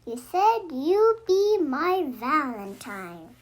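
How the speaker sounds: ordinary delivery, nothing marked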